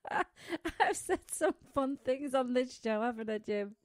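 laughing at your own slips. Real, not acted.

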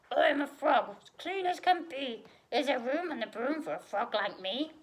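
croaky storybook frog voice; croaking frog. A narrator's reading. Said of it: "said with a croak".

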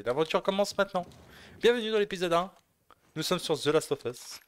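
high, loud show-host voice; announcer intro. Acted.

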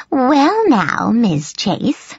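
put-on old nurse's high, gravelly voice with big swoops; gravelly high-pitched nurse. A narrator's reading. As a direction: croak it high, sizing up the student. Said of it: "she said in a gravelly, high-pitched voice".

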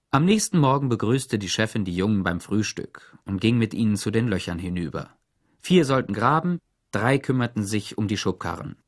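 plain reading voice

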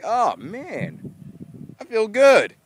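proud to make someone laugh; pleased excitement. Retold or imitated. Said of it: "I was like".

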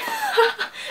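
a sudden high laugh; high burst of laughter. Retold or imitated. Laughing at her date's joke, high laugh. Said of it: "I laughed".

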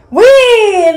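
high, loud squeal of glee; long whee, riding a wave; gleeful whee. A narrator's reading. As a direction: whoop with delight. Surfing through the waves.

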